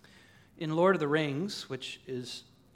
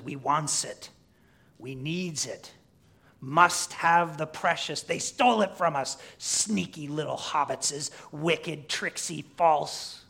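hissed-out creature grumble, drawn out, punched louder; Gollum; 5 dB louder, slower than usual. Acted. Preacher voices Gollum plotting over the stolen ring, scheming. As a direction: play the scheming creature, slow and emphatic. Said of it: "So Gollum says".

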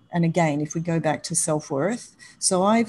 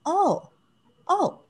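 higher, drawn-out mock disbelief; incredulous; 12 semitones higher than usual. The date realising his double standard, in mock incredulous surprise. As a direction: imitate his dumbfounded gasp of realisation.

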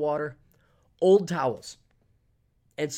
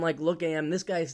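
ordinary talk, nothing marked

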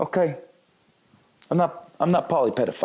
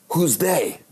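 put-on higher-pitched German-accented grandma; puzzled German grandma accent, pitched up; 5 semitones higher than usual. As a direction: imitate a German grandmother questioning. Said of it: "Remember, she has a German accent".

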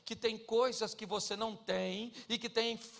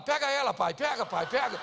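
put-on kid cheering on; 3 semitones higher, 8 dB louder than usual. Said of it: "E o menino fala".

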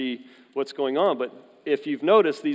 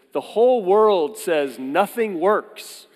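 slow, louder, higher, spelling out each word; earnest broken phrasing; 5 semitones higher, 5 dB louder, slower than usual. Retold or imitated. Son at the mechanic, slow and louder. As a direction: mimic a foreigner straining to explain. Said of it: "He says".

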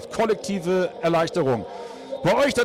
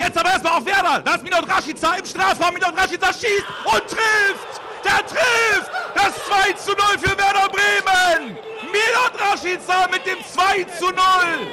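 ecstatic goal call; 9 semitones higher than usual. Real, not acted. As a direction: scream the goal to the listeners. Live radio goal call.

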